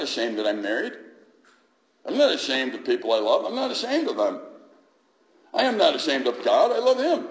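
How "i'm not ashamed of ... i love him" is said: high, loud impassioned preaching; fiery preaching. Acted. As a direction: preach it out with full conviction. Preaching at full force.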